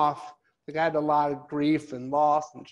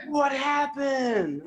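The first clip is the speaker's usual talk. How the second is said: put-on high, very slow kid's question; curious kid; 8 semitones higher, much slower than usual. A curious kid asking the amputee about his legs. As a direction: draw it out wide-eyed like a child. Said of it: "And they're like".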